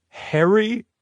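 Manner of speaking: high, disbelieving echo; incredulous. A narrator's reading.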